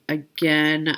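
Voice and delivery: ordinary talk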